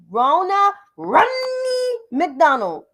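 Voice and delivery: Romney stretched slowly, Ronna Romney McDonald drawn out; how she said it. Retold or imitated. Mocking the RNC chair's name: Romney McDonald drawn out slow. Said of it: "that's how she said her name".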